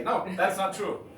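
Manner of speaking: objecting. Shown as a demonstration. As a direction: call out a firm objection from the audience. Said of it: "you raise your hand and you say".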